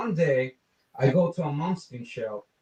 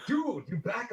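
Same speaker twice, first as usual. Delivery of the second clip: surprised friends; 8 semitones higher, 6 dB quieter, slower than usual. Retold or imitated. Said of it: "they were like".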